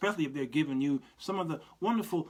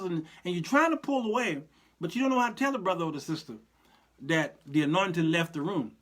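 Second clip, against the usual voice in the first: ordinary talk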